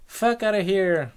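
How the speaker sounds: high, loud echo of his words; high-pitched helpless repeat; echoing nymph. Acted. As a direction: parrot it back high and wailing. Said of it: "And she goes".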